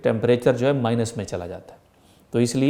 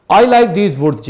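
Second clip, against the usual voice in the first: louder, higher, hearty exclamation; father voiced with open-hearted delight; father's delight; 6 semitones higher, 6 dB louder, much slower than usual. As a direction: let the father savour the woods, slowly. Teacher rereads father's line.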